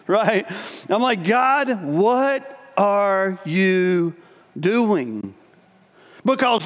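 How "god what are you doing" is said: low, slow plea to God; despairing plea. Retold or imitated. Praying to God, low and slow despair. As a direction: drag it out, bewildered.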